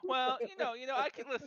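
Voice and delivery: high-pitched, evasive in-character protest; hammed-up high defensive excuse; flustered dodge.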